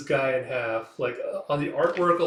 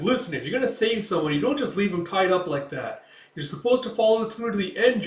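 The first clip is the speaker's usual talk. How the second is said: higher, fast scolding lecture; nagging elf; 7 semitones higher, 4 dB louder than usual. Acted. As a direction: wag a finger at him.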